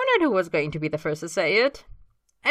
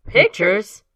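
loud, high one-word question; can't believe it; incredulous echo; 5 semitones higher, 12 dB louder than usual. Acted. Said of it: "James and Austin say at the same time".

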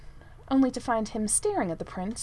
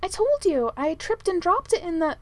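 high-pitched excuse, breaking off; defensive protest; 8 semitones higher, 3 dB louder than usual. A narrator's reading. Manservant explaining an accident to the prince, defensive. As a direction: insist it was not your fault. Merlin defends himself, cut off.

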